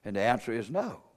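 hushed answer. Real, not acted. Preacher answering his own question, low and quiet. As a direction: drop right down for the answer.

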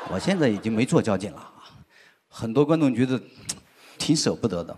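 ordinary talk, nothing marked

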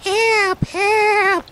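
tiny fire creature, very high and loud; small crackly cry. A narrator's reading. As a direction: squeal for help in a small crackly voice. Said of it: "crackly voice crying".

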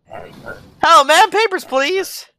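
high, lilting mock-official greeting; playful high-pitched border guard; silly high greeting.

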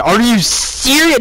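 high, slow cry of disbelief; retold yell. Fan recalling what he said at the kick, retold yell. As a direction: yell it in disbelief, stretching SERIOUS. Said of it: "I, I yelled that".

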